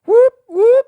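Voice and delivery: high, loud siren-like whoop whoop; police siren whoop. Pretend. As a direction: wail it like a cop siren. Answers cue with siren imitation.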